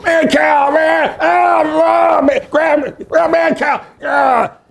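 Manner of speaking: high-pitched shouting impression; Chris Farley yelling. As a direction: bellow it wildly, way up high. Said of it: "And he would grab".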